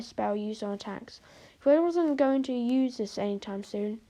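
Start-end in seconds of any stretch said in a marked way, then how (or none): none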